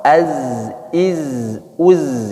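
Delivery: "Az, iz, uz" ended without any echo; sukun zai without bounce. Shown as a demonstration. Teacher showing sukun zai without bounce. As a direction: close each "az" "iz" "uz" flat, no rebound. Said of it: "jangan dipantulkan karena bukan huruf qalqalah".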